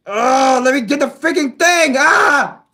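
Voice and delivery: loud, high exasperated outburst; rising shout ending in a scream; frustrated yelling. Real, not acted. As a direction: yell while fighting to get it. Struggling to get the piece.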